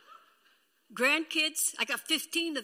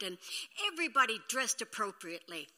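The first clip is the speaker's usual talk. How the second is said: ordinary talk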